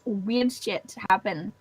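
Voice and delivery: low, husky, worn-out voice. Real, not acted. Player admitting the accent has slipped, husky voice. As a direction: speak low through a failing voice. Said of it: "Your poor voice".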